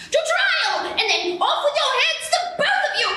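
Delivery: high sentencing cry; furious queen. Acted. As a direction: order the heads off from up high.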